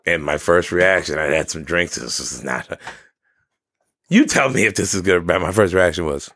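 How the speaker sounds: normal talking voice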